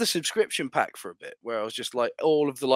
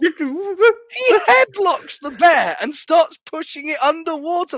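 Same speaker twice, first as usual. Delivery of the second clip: excited shout; 12 semitones higher, 6 dB louder, slower than usual. Real, not acted. Excited retelling of bear fight.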